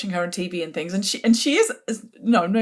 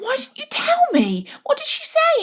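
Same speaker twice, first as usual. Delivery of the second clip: much higher, louder, incredulous demand; outraged disbelief; 5 dB louder than usual. Retold or imitated. Wife demanding her husband explain the insult, incredulous and high. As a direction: fire the questions at him in disbelief.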